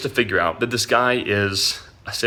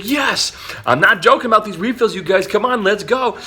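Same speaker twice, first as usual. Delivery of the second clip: a high, lightly voiced pushy waiter; hyped-up waiter imitation; 10 semitones higher than usual. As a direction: egg the table on like the waiter. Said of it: "He's like".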